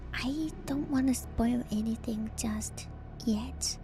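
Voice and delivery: usual speaking voice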